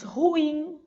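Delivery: "ruim" drawn out on its final syllable; stress on last syllable. Shown as a demonstration. Teacher giving example of oxytone words, final-syllable stress on "ruim". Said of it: "Exemplo de palavras oxítonas".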